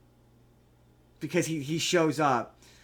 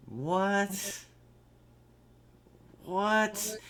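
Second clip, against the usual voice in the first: higher, softer, drawn-out bewildered question; baffled disbelief; 4 semitones higher, 6 dB quieter than usual. Acted. Reviewer mimics viewers faced with the twist, baffled disbelief. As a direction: act out a quietly dumbfounded reaction.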